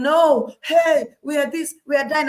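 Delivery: high, loud, fearful protest; panicked wail. Retold or imitated. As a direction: cry out like terrified spies. The ten spies' panicked reply.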